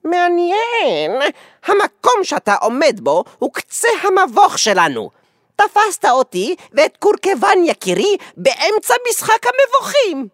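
high, bold, energetic character voice; full of vigor. A narrator's reading. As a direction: announce it with high-pitched bravado. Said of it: "בקול מלא עזוז". (בקול גבוה ומלא עזוז)

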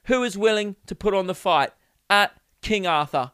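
mock proclamation in a British accent; British challenger. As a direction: call out a rival as a British geezer. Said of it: "If [name] was British".

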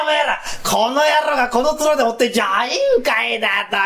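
ordinary talk, nothing marked

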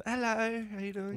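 put-on high, soft baby-talk greeting; gentle high-pitched hello for a baby. Voicing adult greeting a baby.

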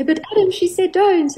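put-on high, tattling little-kid voice; be the small friend reminding Adam of the rule; imitating a little child. Little friend scolding Adam, in a high tattling voice. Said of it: "some of the little ones will say".

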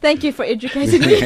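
laughing. Real, not acted. Joking after being corrected.

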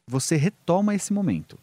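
usual speaking voice

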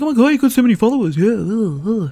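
high, rushed, gushing mimicry; Andrew. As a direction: put on an exaggerated high fanboy voice. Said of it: "He doesn't talk like that".

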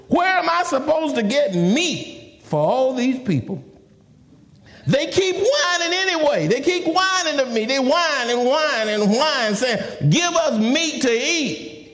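high, fed-up complaint to God; exasperated plea, dragging out the grievance; frustrated Moses. Acted. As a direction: complain at length, pitched well up.

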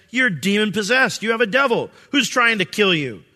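the people accusing Jesus, mildly harsh; harsh accusation. Retold or imitated. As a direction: accuse him, mildly heated. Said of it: "that's a pretty harsh accusation".

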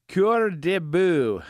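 hammed-up Italian accent, high, loud and slow; bad Italian accent. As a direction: stretch out Cœur de Bœuf like a mock Italian chef. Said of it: "that's a bad Italian accent there".

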